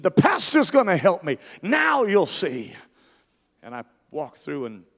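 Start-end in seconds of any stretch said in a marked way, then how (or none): 0.0-2.7 high, loud taunting cry; imitated possessed scream. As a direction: shout it, stretching out the last words. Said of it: "He screamed".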